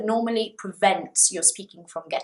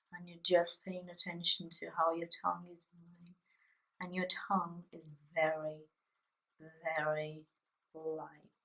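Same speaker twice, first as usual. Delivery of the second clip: quiet words through nearly closed lips; talking with mouth closed; 2 semitones lower, 13 dB quieter than usual. Shown as a demonstration. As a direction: keep the mouth shut and the tongue loose. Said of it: "you're talking like this".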